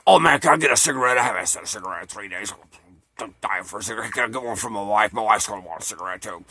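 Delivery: fast, low boozy wrestler plea; Papa Jimmy impression. Moocher bumming smokes from a tourist, low boozy rush. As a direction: imitate an alcoholic Hulk Hogan begging, quick and low. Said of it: "try to do an imitation of Jimmy's voice".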